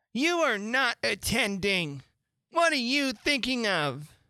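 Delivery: high, slow, indignant reprimand; cross scolding. A narrator's reading. Mouse scolds Alice for not attending, high and cross. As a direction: scold an inattentive listener.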